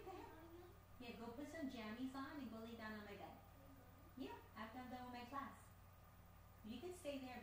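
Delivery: mother speaking softly to her daughter, far off; far from the mic. Real, not acted. Talks to daughter away from mic.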